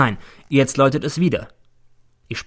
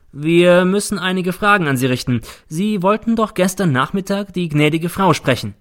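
police official, pitched up and pressing; questioning official; 7 semitones higher, 4 dB louder than usual. Acted. Official questions teacher before the lady, formal and pressing. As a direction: question formally in a raised, sustained voice.

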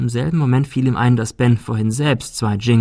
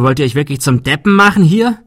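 louder, higher panicked outburst; 5 semitones higher, 7 dB louder than usual. Acted. Adrian panics at the others, loud and frantic. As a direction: yell at them in panic. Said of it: "Er wurde laut und klang panisch".

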